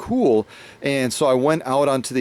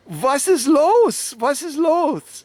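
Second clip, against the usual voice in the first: high, slow, puzzled asking; baffled foreigner asking what's so funny; high and slow, asking laughing shopkeepers what's wrong; bewildered; 14 semitones higher, much slower than usual. Retold or imitated. Confused while everyone laughs.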